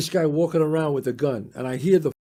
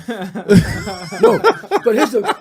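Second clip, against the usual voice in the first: laughing; 4 semitones higher, 7 dB louder, much slower than usual. Real, not acted. Laughing after friend's joke.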